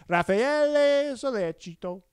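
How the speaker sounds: Raffaele Sollecito drawn out slow, high and loud, every syllable overemphasised; overdone Italian accent. Shown as a demonstration. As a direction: belt out "Raffaele Sollecito" like a mock Italian, almost insulting. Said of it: "so you gotta be like this".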